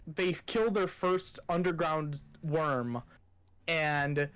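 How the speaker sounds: ordinary talk, nothing marked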